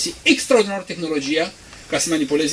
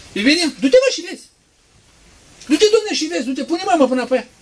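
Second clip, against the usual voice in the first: much higher, loud jeering orders; mocking scoffer; 11 semitones higher, 6 dB louder than usual. Preacher mocks scoffers sending someone to check. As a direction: jeer at them, high and loud.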